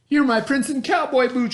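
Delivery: delighted shout. Acted. Miss Lurleen thrilled to find Bubba, high and loud. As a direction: cry it out in joy. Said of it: "shouted Miss Lurleen".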